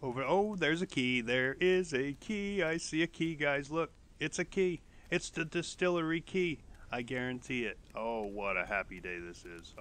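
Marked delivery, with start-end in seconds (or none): 0.2-6.6 excited. Real, not acted. Finds the key he wanted.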